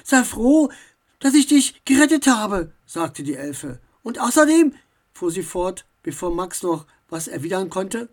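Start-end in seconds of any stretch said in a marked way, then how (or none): 0.0-2.7 loud, high, scolding elf; huffy self-defence; indignant retort. A narrator's reading. Elf quarrels with Max. 4.0-4.8 loud, high, insistent add-on; huffy elf cutting in; indignant retort. A narrator's reading. Elf carries on the quarrel.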